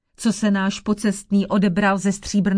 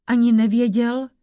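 ordinary delivery

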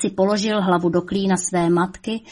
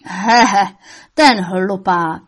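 mocking laugh; 7 dB louder, slower than usual. A narrator's reading. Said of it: "smál se satanáš".

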